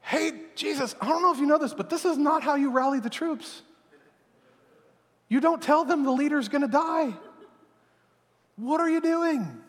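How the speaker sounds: high, exasperated lecture; scolding Peter. Retold or imitated. Peter telling Jesus this is no way to rally troops. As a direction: set your leader straight in disbelief. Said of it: "And so Peter's like".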